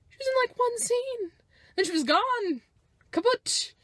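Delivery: high, loud, incredulous rant; exasperated rant. Real, not acted. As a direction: vent it, voice up high. Ranting about Phasma.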